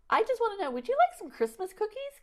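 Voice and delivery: high, sunny cookie offer; friendly offer. Retold or imitated. Offering a grumbling stranger Christmas cookies in a high bright voice. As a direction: brighten his day with cookies.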